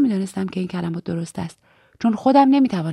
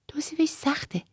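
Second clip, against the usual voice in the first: higher, whispery and softer; hushed confiding; 7 semitones higher, 6 dB quieter than usual. A narrator's reading. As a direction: whisper it as if unsure how to put it. Continuing the hushed secret conversation.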